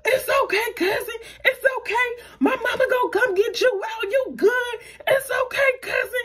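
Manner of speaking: very high, squealing call-out; mock hollering. Cousin hollering out the bus window, high-pitched mock holler. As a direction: imitate a cousin hollering reassurance in a high, thin voice. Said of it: "my cousin decides to holler out the window".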